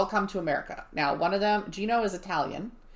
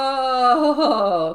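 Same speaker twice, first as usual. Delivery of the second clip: long, loud, high "oh"; dawning realization; 6 semitones higher, 7 dB louder than usual. Retold or imitated. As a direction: draw it out as the penny drops. Retold moment of realization.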